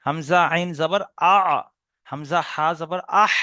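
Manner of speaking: drawn-out আ and আহ from mid-throat; slow throat letter demo. Shown as a demonstration. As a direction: sound আ and আহ slowly from the middle of the throat. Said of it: "গলার মাঝখান থেকে উচ্চারণ হয়". (ধীরে গলার মাঝখান থেকে আ আর আহ উচ্চারণ)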